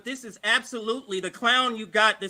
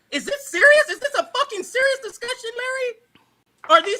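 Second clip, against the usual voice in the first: high, loud exasperated outburst; real outrage, voice shooting up; outraged; 7 semitones higher, 4 dB louder than usual. Real, not acted. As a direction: explode in disbelief at the host. Outraged shout at host.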